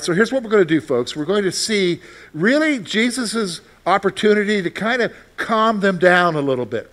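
ordinary talk, nothing marked

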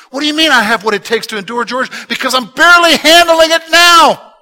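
rising to a desperate shout; fed-up listener shouting back at the preacher; exasperated objection. Pretend. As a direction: voice a worn-out believer crying out. Pastor voices a struggling listener.

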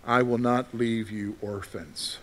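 soft, slow reading of the verse; hushed and slow, almost a comfort; gentle scripture reading. Shown as a demonstration. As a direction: read it softly, like a promise. Listen to what he says.

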